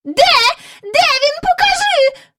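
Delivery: very high, loud gasping cries; breathless excited lady. A narrator's reading. Miss Lark breathlessly begging Michael to show her. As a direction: gasp it out in a rush. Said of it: "вигукнула міс Жайворон".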